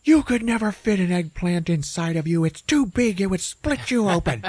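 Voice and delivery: put-on a boyfriend aghast at the idea; hammed-up shocked objection; mock alarm. Frank aghast at eggplant.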